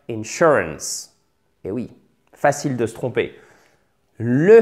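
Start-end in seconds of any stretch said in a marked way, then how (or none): 0.1-1.2 "Insurance" at half speed, stress on SUR; very slow model. Shown as a demonstration. Teacher drilling learners, very slow careful model. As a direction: stretch out "Insurance", in-SUR-ance. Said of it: "deuxième syllabe".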